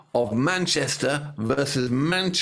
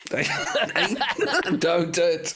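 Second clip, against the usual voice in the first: laughing; 8 semitones higher than usual. Real, not acted. Laughing at co-host's plea.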